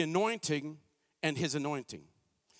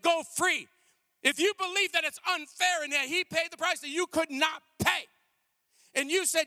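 rising fiery preacher, louder and higher; booming motivational speech; 8 semitones higher, 7 dB louder than usual. Acted. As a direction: preach it with building force. Preaching builds to full force.

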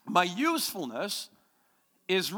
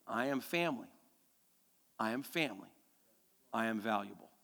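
hushed declaration; 9 semitones lower, 9 dB quieter than usual. Shown as a demonstration. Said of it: "Say that with me".

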